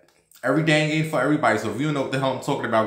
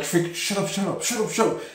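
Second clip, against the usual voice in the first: higher-pitched panicked warning; urgent hush; 8 semitones higher, faster than usual. Retold or imitated. Embarrassed teen on the toilet hushing Frankie, high panicky warning. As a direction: hush him before anyone hears. Begging friend to be quiet.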